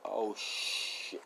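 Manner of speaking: hushed, whispered realisation; acted soft oh-no whisper; dawning dread. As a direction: breathe it out slowly under your breath. Voicing dad's dawning realisation.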